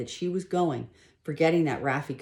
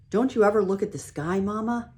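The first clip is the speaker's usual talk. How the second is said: teasing son; 6 semitones higher, 4 dB louder than usual. Acted. Lewis laughs at his mother.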